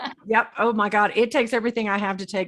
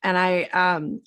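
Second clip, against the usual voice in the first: ordinary delivery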